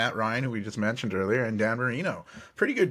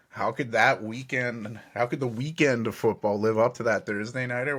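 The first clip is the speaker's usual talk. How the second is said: usual speaking voice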